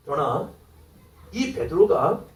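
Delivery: normal talking voice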